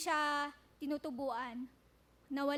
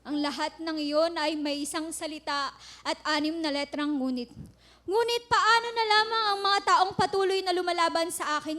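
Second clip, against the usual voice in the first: loud, passionate poem recital; spoken word poetry; 9 dB louder than usual. Acted. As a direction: declaim the poem with building fervor.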